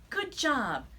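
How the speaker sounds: high, loud sarcastic praise; fake praise. Chris fakes praise for his rival Sammy. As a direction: gush it with a fake smile. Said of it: "a big fake smile".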